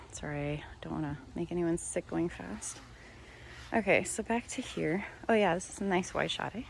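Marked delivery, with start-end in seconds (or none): 0.1-2.8 hushed whisper; breathy little sorry, barely voiced; whispered aside. Real, not acted. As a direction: drop to a whisper to apologise. Apologises for the fast camera pan.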